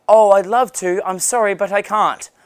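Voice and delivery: a touch of mock regret; polite apologetic refusal. Acted polite refusal of a favour.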